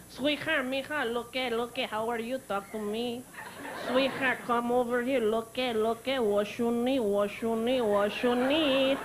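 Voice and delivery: mock Mexican catcaller, last words drawn out; Mexican accent. Mexican guy hitting on her, mock Mexican accent. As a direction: flirt insistently in a put-on Mexican accent.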